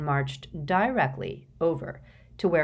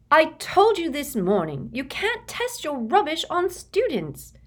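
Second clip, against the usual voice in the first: higher, loud angry scold; 11 semitones higher, 4 dB louder than usual. A narrator's reading. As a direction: tell them off sharply. Hermione scolding twins.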